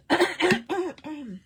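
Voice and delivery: sharp, loud throat-clearing coughs; real coughing fit. Real, not acted. Crafter coughing mid-demo with a frog in her throat, loud coughs. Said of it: "Je vais juste prendre un peu d'eau".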